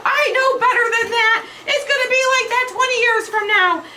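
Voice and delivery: high, hammed-up exasperated protest; wailing mock indignation, rushing at the end; mock outrage. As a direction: imitate a mom who isn't buying it. Acting out mom's outraged reaction.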